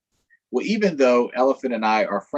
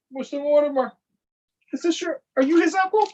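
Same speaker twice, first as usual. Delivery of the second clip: high, teasing question to a grownup; high playful questioning; 5 dB louder than usual. Acted. Storyteller asking Mister Mortimer, high and teasing. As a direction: ask in a bright mock-curious voice.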